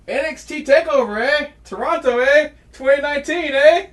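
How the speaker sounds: high, loud Canadian "eh" announcement; mock Canadian. Announcing the Toronto TakeOver card, mock Canadian. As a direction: punch each "eh" in a jokey Canadian lilt.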